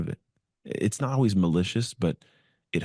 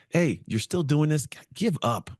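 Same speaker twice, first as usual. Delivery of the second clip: much higher, slow scornful jeer; mocking teammate; 10 semitones higher than usual. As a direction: needle him to quit. Teammates mocking in third month.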